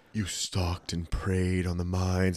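low, slow, quiet menacing monologue; villain's monologue. Acted. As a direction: drag it out like a TV killer's speech. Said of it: "then he goes into his monologue".

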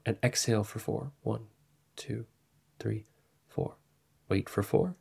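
soft, fading count on the exhale. Shown as a demonstration. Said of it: "I invite you to try right now".

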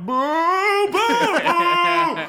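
high, shouted mock boos from the crowd; crowd booing. Rowdy audience booing the comic off the stage, shouted high boos. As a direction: boo him off like a rowdy audience.

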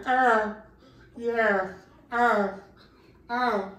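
imitating her loud high moans, yeah; mock moaning. Deaf partner moaning during sex, mock loud moans. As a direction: moan it out, loud and high, hammed-up. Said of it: "she's just in there going".

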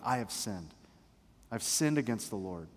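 low, soft admission of guilt; sincere confession. Acted. David taking responsibility before Nathan, sincere confession. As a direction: own it quietly, without tearfulness. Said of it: "He's taking responsibility".

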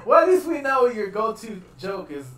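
high, loud laugh into the words; laughing teasing. Real, not acted. Teasing a friend about his go-to joke while laughing. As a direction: crack up, then tease him.

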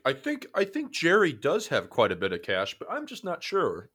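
lightly voiced doubtful young woman; mock interview voice.

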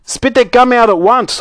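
high, urgent fatherly command; stern order. Acted. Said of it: "ordered Mister Beauregard".

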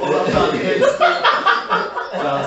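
high, laughing speech; cracking up mid-sentence. Real, not acted. Laughing while talking.

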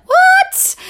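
very high, loud squeal of disbelief; shocked outburst. Retold or imitated. Wife retelling hearing they'd go to Yemen, shocked shriek. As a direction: shriek it in mock astonishment.

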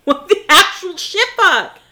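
slow emphatic disbelief; outraged. Real, not acted. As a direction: lean into the outrage. Echoes outraged question.

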